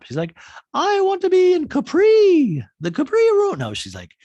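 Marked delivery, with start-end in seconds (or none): 0.7-3.6 very high, loud, slow put-on wail; Dorit impression. Dorit crying out in EMDR session, high loud put-on voice. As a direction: squeal it out like a melodramatic star.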